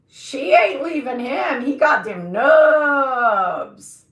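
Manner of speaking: loud, hammed-up admiring voice; mock admiring. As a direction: play up the awe, bigger each time. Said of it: "But I was like".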